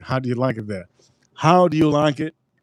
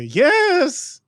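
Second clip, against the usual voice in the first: customer saying yes; 14 semitones higher than usual. Retold or imitated. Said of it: "You know they're gonna say".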